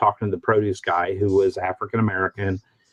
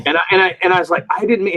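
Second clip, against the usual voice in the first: flustered stammer; 7 semitones higher, much faster than usual. Retold or imitated. Flustered apology retold.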